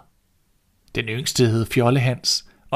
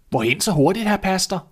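higher, louder surprised call; 8 semitones higher, 3 dB louder than usual. Acted. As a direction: call out in astonishment. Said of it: "råbte han".

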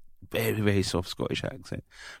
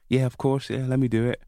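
higher-pitched, keen acceptance, retold; eager yes; 6 semitones higher than usual. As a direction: act out an over-willing newcomer. Acts out younger, over-willing self.

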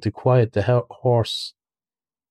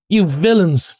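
the rat yells at the road hogs; shouting; 6 semitones higher, slower than usual. A narrator's reading. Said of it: "he shouted".

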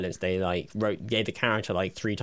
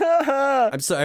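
drawn-out apology; 7 dB louder, much slower than usual. Acted. Remorse after outburst.

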